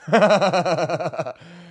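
loud rising laugh. Real, not acted. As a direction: burst out laughing at the burden. Laughing about the book.

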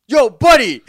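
loud, high call across the bar; shout. Retold or imitated. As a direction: yell at the heckler to get his attention. Calling out a heckler.

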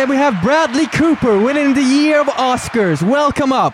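hosting an awards show, announcing the winner. Acted. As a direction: welcome the winner on stage. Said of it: "vi är på Golden Awards".

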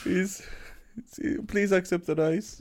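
hint of an old grandma voice. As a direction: plead like a grandma apologising.

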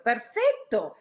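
ordinary talk

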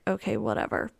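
a bored, low "whatever" as the husband; dismissive husband. Retold or imitated. Husband shrugging off her cat plan, low and dismissive.

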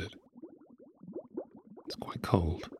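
soft echoing repeat; cave echo. Acted. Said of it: "hear the echo of your subconscious".

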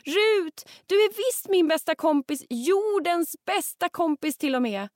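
high, loud desperate shout; frightened call. Acted. Frightened Hetty shouts to lost Rut, loud call. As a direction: call out scared, begging her back. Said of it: "blir Hetty riktigt rädd och ropar".

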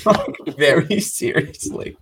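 high-pitched, slow words through laughter; real amusement. Real, not acted. Telling Bill about the rival fan's insult, laughing. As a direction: laugh as you explain it.

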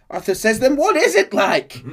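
high, loud outraged demand; angry shout. Acted. Arthur arguing with Dutch over Abigail, high angry shout. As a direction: shout it back as a furious challenge.

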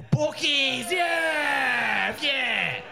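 high, loud cheer with drawn-out yeahs; jubilant shout. Real, not acted. Comic celebrates the third lad's job, jubilant shout. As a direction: celebrate it like a win.

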